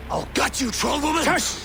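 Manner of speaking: high, loud enraged yell; raging captive shouting at the traitor; furious shout. Acted. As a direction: scream the threat at her. Captive lunging at accuser.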